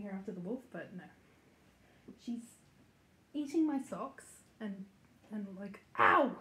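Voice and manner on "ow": loud, slowed yelp of pain; sharp ow. Real, not acted. Puppy bites her thumb, she yelps loudly. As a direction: yelp as teeth hit the thumb.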